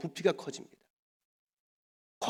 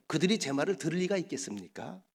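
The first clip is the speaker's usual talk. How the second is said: ordinary talk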